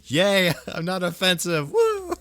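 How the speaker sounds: high, loud giddy whoop; laughing high cheer; squealing cheer. Real, not acted. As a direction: squeal with joy. Cheering about being inoffensive.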